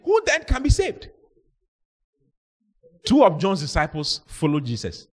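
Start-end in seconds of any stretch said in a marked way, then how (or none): 0.0-1.1 high, astonished question; shocked question. Acted. Shocked disciples ask Jesus who can be saved, astonished. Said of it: "They were shocked".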